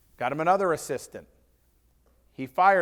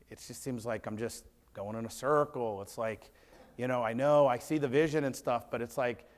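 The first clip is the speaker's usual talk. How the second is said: mopey retold quote; 4 semitones lower, 7 dB quieter than usual. Discouraged student venting to owner.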